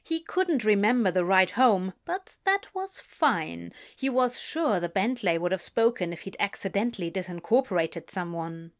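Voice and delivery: usual speaking voice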